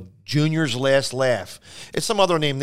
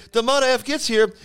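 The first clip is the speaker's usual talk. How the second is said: much higher, indignant retelling; worked-up club owner; 12 semitones higher than usual. Retold or imitated. Club owner piles on another complaint, worked up and high. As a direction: pitch it up like a man mid-rant. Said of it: "He goes".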